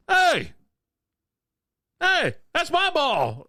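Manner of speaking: high, loud, drawn-out yell across the course; yelled quote. Retold or imitated. Mimicking a distant golfer yelling about his ball, high slow shout.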